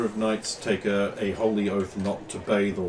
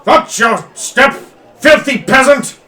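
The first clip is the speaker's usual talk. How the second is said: high, loud barked insult at a peasant; furious noble; 13 semitones higher, 15 dB louder, slower than usual. Acted. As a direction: bellow down at the filthy peasant. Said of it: "And he turns around here".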